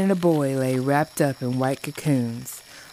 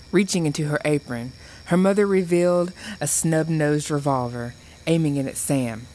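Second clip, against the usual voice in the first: ordinary delivery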